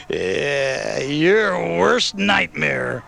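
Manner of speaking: slow, stretched, gloating menace; laughing villain. A narrator's reading. Wolf answering Stephanie, gloating after a laugh. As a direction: drag the words out with relish. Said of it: "he laughed".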